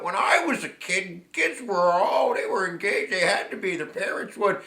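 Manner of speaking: lightly voiced old-timer grumbling about kids these days; grumpy old-timer voice. Mocking grumpy old-timer complaints.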